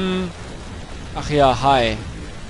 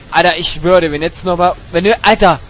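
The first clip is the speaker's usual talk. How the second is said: 1 angry outburst; 2 semitones lower, 5 dB louder than usual. Real, not acted. Raging at the game.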